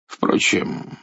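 normal talking voice

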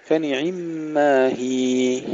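slow chant, "هي" ending on a held long ee; two-count long ya. Shown as a demonstration. Teacher models stopping on the word هي, lengthened ya. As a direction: stretch the final ي of "هي" evenly. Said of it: "نمد الياء حركتين".

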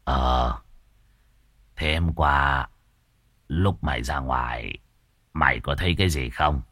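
put-on low, quiet old man probing; gruff old master. Old master questions servant.

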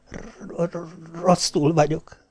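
high, faint stutter on the first sound; shivering stammer. A narrator's reading. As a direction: chatter it out weakly, high and soft. Shivering wife stammers she's unwell. (magas, halk, reszkető dadogás)

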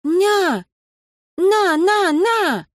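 put-on a little boy demanding to be held; toddler babble. A narrator's reading. Toddler Ilyusha reaching for Anton, high babbling.

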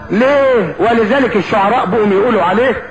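ordinary delivery, nothing marked

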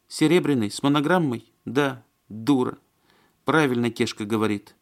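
high-voiced woman, self-reproachful; Маня. A narrator's reading. Woman calling herself a fool over a gift, rueful.

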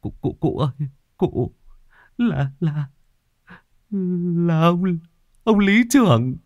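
stutter, building to a shrill cry of terror; terrified stammer. Acted. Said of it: "Anh Sử run run". (người hầu run rẩy, hoảng sợ tột độ)

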